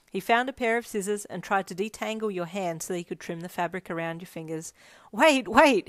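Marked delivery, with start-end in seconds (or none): 5.1-5.9 loud, high yelp. A narrator's reading. Friend halting the scissors mid-twist, loud high yelp. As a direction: yelp it in sudden alarm. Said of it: "he yelped".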